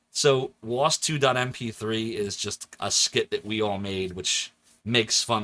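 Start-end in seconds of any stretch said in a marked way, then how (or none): none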